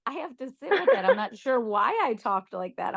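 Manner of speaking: mock bewildered self-reflection. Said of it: "I'm like".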